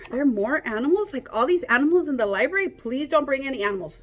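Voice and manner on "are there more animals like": usual speaking voice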